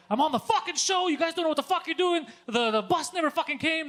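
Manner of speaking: high, loud indignant rant; worked-up yell, pitched right up; retold yelling. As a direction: blow up at the staffer, retelling it. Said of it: "he's just yelling all the time".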